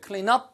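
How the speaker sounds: "Clean up" said as klee-nup; linked pronunciation. Shown as a demonstration. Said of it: "넙처럼 들려요".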